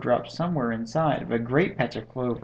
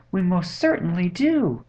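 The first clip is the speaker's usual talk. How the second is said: higher-pitched tiny voice, lightly done; piping; 6 semitones higher, 3 dB louder than usual. A narrator's reading. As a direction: pipe up eagerly for a little creature. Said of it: "piped the voice".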